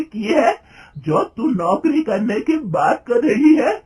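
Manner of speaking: loud, high, tearful scolding; a furious mother-in-law berating her daughter-in-law for wanting a job; angry mother-in-law scolding. A narrator's reading. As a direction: scold the daughter-in-law hotly, near tears. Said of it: "गुस्से में कहती है".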